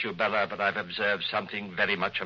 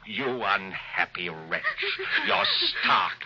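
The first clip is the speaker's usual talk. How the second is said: contemptuous rage. Acted. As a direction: spit the insult at her. Husband rages at wife.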